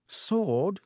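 vague, puzzled rising question; dimly. A narrator's reading. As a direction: sound blank and lost. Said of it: "said Lockhart dimly".

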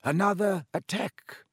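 a lightly voiced grave Dumbledore; old headmaster. A narrator's reading. Dumbledore telling Pomfrey, gravely. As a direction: say it like grim news.

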